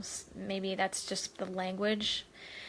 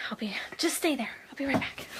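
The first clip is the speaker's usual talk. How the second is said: whispered promise; 4 semitones higher, faster than usual. Real, not acted. Leaving viewers to fetch friend.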